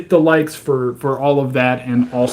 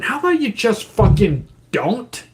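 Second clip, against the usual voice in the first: higher incredulous snap; exasperated retort; 9 semitones higher than usual. Real, not acted. Retorting to the government's red dye plan, higher and incredulous. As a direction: shoot back at the officials.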